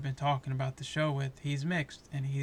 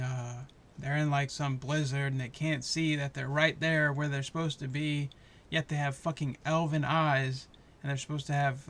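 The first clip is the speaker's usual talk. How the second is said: ordinary talk, nothing marked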